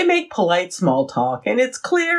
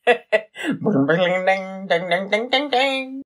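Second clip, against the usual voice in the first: chiming bell; 2 semitones higher than usual. Pretend. Chimes a warning.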